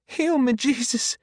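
very high voice of a praying woman; pleading matron. A narrator's reading. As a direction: plead far up in pitch. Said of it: "the matron mutters".

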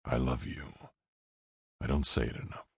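soft and low, almost hushed; quiet. A narrator's reading. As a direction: say it softly, close. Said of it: "Zero says quietly".

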